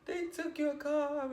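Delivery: high, tearful imitation of crying to mom; retold crying. Daughter breaking the bad news to her mom, imitated crying. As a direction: imitate a high-pitched sobbing wail, drawn out. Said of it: "I broke down crying".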